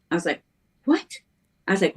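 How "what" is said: high, surprised squeak of disbelief; retelling her surprise at the roommate's news, high and astonished; shocked what. Retold or imitated. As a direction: let the pitch jump up in disbelief. Retold shock at nun news.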